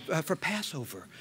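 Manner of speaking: very soft, hesitant student imitation; nervous student. Student confessing Passover plans to professor, mock nervous. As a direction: murmur it like a timid student. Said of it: "they're so nervous 'cause they're speaking".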